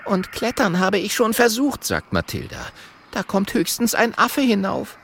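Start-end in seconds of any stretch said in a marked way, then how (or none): 0.1-1.8 high critter voice; high-pitched animal character; little porcupine character. Acted. 3.1-5.0 high critter voice; high-pitched animal character; little porcupine character. Acted.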